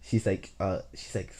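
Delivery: ordinary delivery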